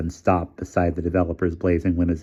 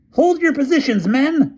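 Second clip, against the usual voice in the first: very high, louder order; screamed order; 6 dB louder than usual. A narrator's reading. Featherstone screaming orders behind the flagpole. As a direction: command your men under fire. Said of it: "screamed Featherstone".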